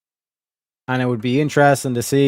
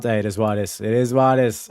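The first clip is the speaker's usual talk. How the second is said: ordinary talk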